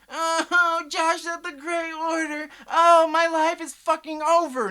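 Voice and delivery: hammed-up whiny wail, high-pitched and loud; mock a fan crying that his life is over. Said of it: "You see me sitting here crying about it?".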